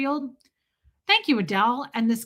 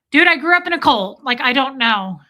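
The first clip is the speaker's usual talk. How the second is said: exasperated comeback; 3 semitones higher, 9 dB louder than usual. Retold or imitated. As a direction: burst out in protest, retelling it. Retold exasperated comeback to veteran.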